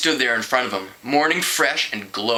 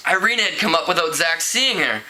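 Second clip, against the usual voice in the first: usual speaking voice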